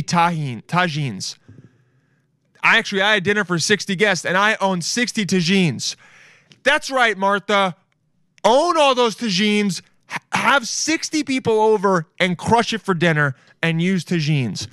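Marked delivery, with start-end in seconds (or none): 2.6-6.0 higher-pitched, faster, excited quote; hyped-up rereading. Retold or imitated. Fan repeating Martha's reply, hyped-up boast. Re-reads response, now hyped up. 6.6-14.7 high-pitched, emphatic hype; excited cheering. Real, not acted. Fan cheering Martha on, excited and high-pitched.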